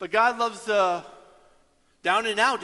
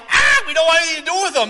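loud fast dismissive shout; mock shout; 11 semitones higher, 13 dB louder, much faster than usual. Early Christians rejecting Paul, loud dismissive shout. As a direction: wave him off loudly, fast.

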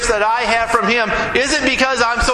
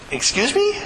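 higher, disbelieving question; incredulous excuse me; 7 semitones higher than usual. Retold or imitated. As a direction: ask it as if you misheard. Stunned by lady's boast.

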